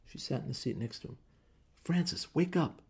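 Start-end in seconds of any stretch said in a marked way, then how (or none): 1.8-2.8 urgent call. Acted. Trying to wake sleeping Digby.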